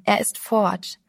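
ordinary delivery

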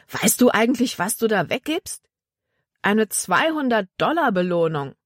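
high, indignant bandit voice; incredulous bandit. Acted. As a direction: argue in disbelief over lost money.

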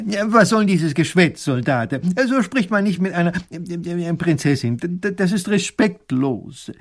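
höher, empört und stockend; entrüsteter alter Professor weist zurecht; ärgerlich. Acted. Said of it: "der Professor sagt ärgerlich". (Professor tadelt den Soldaten empört und stotternd)

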